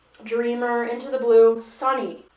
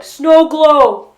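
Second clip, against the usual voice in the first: loud, high, over-the-top blurt; mock excited shout; 5 semitones higher, 15 dB louder than usual. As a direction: yell it like a brilliant brainwave. Mocks the namer blurting it out.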